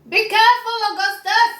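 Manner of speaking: high, loud warning shout. Acted. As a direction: warn him sharply. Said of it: "shouted Mister Gloop".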